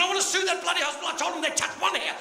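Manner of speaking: high, indignant complaint; mock-outraged patient; indignant rant. Outraged patient rants to solicitor.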